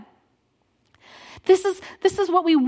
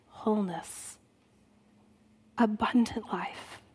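lower, much softer, fading to a whisper; tender forgiveness; 5 semitones lower, 9 dB quieter than usual. Retold or imitated. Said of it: "he says".

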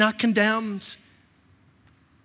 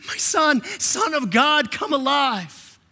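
higher, slow, ringing call; exalted call from the Father; 5 semitones higher, slower than usual. Acted. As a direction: lift it up and stretch come alive. Father calling Jesus again.

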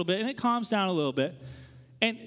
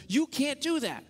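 higher-pitched mock indignant scolding; mad; 6 semitones higher, slower than usual. Street preachers scolding him behind the booth, mad. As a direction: imitate an outraged preacher's protest, without shouting. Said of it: "And they're really mad".

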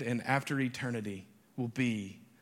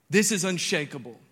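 higher, louder unshakable; emphatic declaration; 4 semitones higher, 7 dB louder than usual. Shown as a demonstration. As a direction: proclaim it boldly. Repeats declaration.

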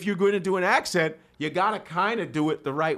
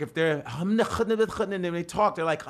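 ordinary delivery

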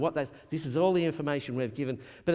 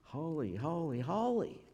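hushed, slow awe at God's holiness; soft, stretched-out reverence; awed reverence; 2 semitones higher, 7 dB quieter than usual. Retold or imitated. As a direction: let each holy land softly, drawn out. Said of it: "it's not just a little bit holy".

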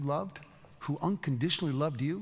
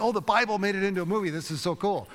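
higher, louder, quick burst of geeky joy; excited delight; 6 semitones higher, 5 dB louder than usual. Retold or imitated. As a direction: gush like a thrilled fan. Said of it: "It's like".

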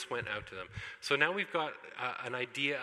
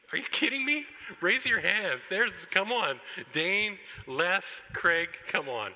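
loud, high, playful disbelief; mock incredulity; 6 semitones higher, 6 dB louder, slower than usual. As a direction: badger the audience to admit it, jokingly outraged. Mock disbelief at congregation's silence.

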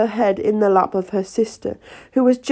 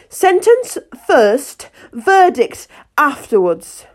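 high, slow, loud royal order; imperious queen; 5 semitones higher, 4 dB louder, slower than usual. A narrator's reading. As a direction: bellow it down like a tyrant queen.

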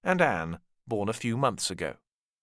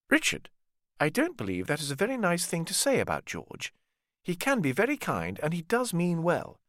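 lightly higher, mild maternal rebuke; gently reproving mother; 6 semitones higher than usual. Acted. Cecily defends George to Richard, mild maternal rebuke. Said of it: "Cecily answered".

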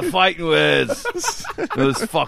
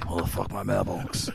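low, soft lightly done Jason Statham; a hint of a gruff London hard man; Jason Statham impression; 8 semitones lower, 9 dB quieter than usual. Comedian doing a quiet Statham voice about the mailbox. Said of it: "I'm doing Jason Statham".

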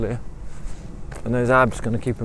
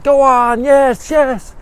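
very high, loud squealing cheer; ecstatic cheering; 8 dB louder, much slower than usual. Acted. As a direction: shriek with delight at the gallop. Marianne cheers his gallop.

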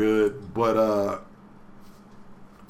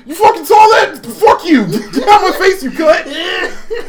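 much higher, louder hammed-up yelling; mock furious shout; 10 dB louder than usual. Haseo yelling at the girl who saw him, hammed-up shouted fury. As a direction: blow up, embarrassed and raging. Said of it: "And he's like".

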